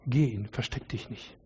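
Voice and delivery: a whispered, intimate urging; hushed plea. Real, not acted. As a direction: whisper it close, like a secret encouragement. Pastor's closing hushed exhortation.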